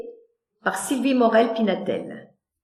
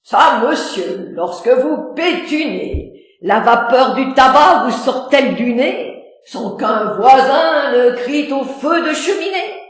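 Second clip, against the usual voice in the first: loud, booming and colourful; big earthy bluster; truculent; 3 semitones higher, 5 dB louder than usual. Acted.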